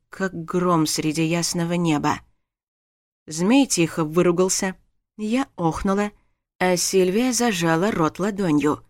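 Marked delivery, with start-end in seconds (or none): none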